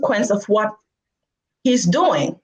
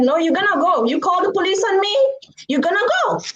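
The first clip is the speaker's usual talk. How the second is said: higher-pitched, insistent retold scolding; mock scolding mother; 11 semitones higher, faster than usual. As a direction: imitate a mother refusing to back down. Mother plays along, scolding the child.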